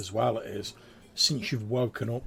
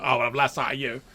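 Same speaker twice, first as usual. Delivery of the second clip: gruff guard; 3 semitones higher, 6 dB louder, faster than usual. Acted. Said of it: "he's like".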